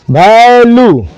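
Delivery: वैल्यू drawn out in two parts, val and yoo; वैल्यू said slowly for a class; sounded out. Shown as a demonstration. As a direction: stretch both syllables of वैल्यू. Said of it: "उसके बाद क्या आ रहा है?".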